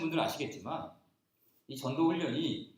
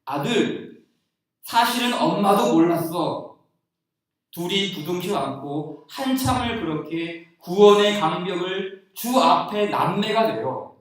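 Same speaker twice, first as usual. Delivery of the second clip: tender testimony; 3 semitones higher, 10 dB louder, slower than usual. Retold or imitated. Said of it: "간증을 쓰는 걸 봤어요".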